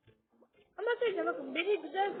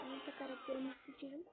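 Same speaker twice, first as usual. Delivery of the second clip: ordinary talk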